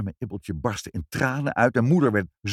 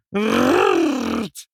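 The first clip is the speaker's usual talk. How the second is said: high, loud rolled r noise; rolled trill; 13 semitones higher, 6 dB louder than usual. A narrator's reading. Mother imitates mice in her report, trilled noise.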